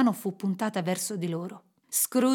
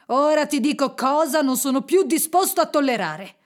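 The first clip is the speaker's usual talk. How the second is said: loud, higher scolding; stern reprimand; 5 semitones higher, 6 dB louder than usual. A narrator's reading. Scrooge warns his trembling clerk, stern. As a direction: lay down the law.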